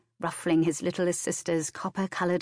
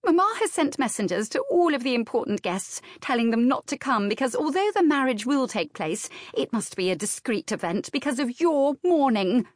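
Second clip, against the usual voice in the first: higher-pitched, complaining younger sister; a hint of sisterly grievance, pitched up; lightly voiced aggrieved sister; 7 semitones higher than usual.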